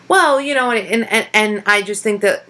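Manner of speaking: halting false starts, stumbling over fillers; imitated tongue-tied speaker. Imitating people met lately, tongue-tied. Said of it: "a lot more people being tongue-tied".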